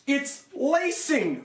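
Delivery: high, slow, disgusted drawn-out lacing; frustrated. Real, not acted. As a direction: groan over the cheap lacing. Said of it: "this is really frustrating".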